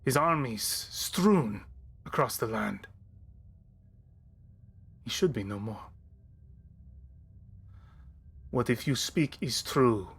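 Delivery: measured, mournful noble fighter; solemn warrior voice. Acted. DM voices grieving warrior NPC.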